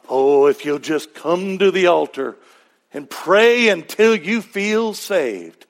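put-on southern-accented altar call, stretching the last words; southern accent preacher. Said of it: "I slipped in my southern accent".

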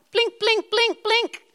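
rising high blink blink calls; playful blinking alarm sound; blinking signal. Pretend. Preacher mimics God's blinking signal.

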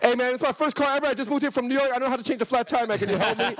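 high pleading stranded driver; desperate plea. Retold or imitated. Begging the tow truck guy for help, high desperate plea. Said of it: "I gave him the desperate look like".

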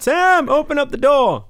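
high, loud mock call to the bus driver; mock shout. Host voicing Sandra Bullock running for the bus, hammed-up shout. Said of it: "Hon springer och skriker att".

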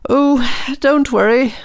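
very high cheerful little voice; squeaky high tiny star; sparkly tinkling star voice. Acted. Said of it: "said the star".

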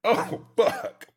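strained after choking on water; coughing up water. Real, not acted. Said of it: "water went down the wrong hole".

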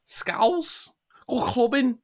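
high-pitched mock Scouse; Scouse accent. Shown as a demonstration. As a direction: put on a squeaky Scouse caricature. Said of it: "I don't think you can do a Scouse one".

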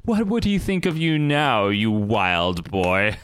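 slow, taunting villain voice; Sinister. Retold or imitated. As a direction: savor the taunt, stretching the words. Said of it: "He's like".

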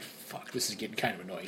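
low, soft grumble to himself; muttered annoyance. Retold or imitated. Driver pinned by sleeping friend, quiet grumble. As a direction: mutter it under your breath. Annoyed thought while driving.

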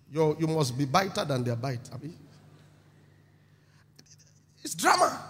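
ordinary talk, nothing marked

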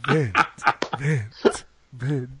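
mock echo dying out; fake echo. Echoing vent, fading out.

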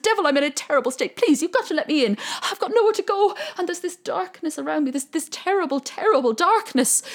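fast, high, loud begging; pleading Jack. Acted. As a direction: rush the plea, desperate to get in. Jack begs the devil.

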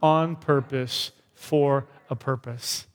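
"God created me on purpose for a purpose" slow, fading at the end; slow recited affirmation; slow unison declaration. Shown as a demonstration. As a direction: lead the line slowly with the room. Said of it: "Let's say that last line".

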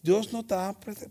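ordinary delivery

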